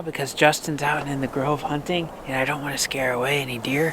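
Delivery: hushed, not to scare deer; talking quietly. Real, not acted. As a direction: keep it low and quiet. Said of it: "I'm trying to talk quiet right now".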